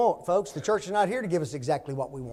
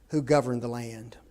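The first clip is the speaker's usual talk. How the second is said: ordinary talk, nothing marked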